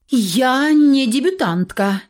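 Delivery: slow, indignant retort; indignant flare-up. A narrator's reading. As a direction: flare up, offended. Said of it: "вспыхнула Луиза".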